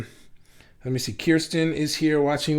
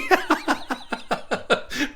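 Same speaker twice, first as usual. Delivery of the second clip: high giggle; 12 semitones higher, 4 dB quieter than usual. Real, not acted. Robin's snowflake comment amuses him.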